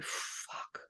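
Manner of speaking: breathy whisper under the breath; whispered curse. Real, not acted. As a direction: mutter it to yourself, barely voiced. Whispered aside to herself.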